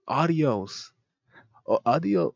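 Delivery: unconvincing Spanish attempt. Shown as a demonstration. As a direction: try and fail at Spanish. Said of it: "I can't fake it".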